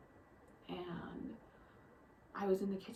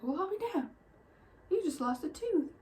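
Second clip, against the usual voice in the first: amazed exclamation; 8 semitones higher, 7 dB louder than usual. Retold or imitated. Grandma amazed at lost tooth.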